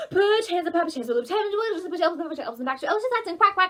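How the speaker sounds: frantic very fast shouted chant; supersonic speed. Shown as a demonstration. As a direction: rush through it as fast as you can. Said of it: "supersonic warp speed".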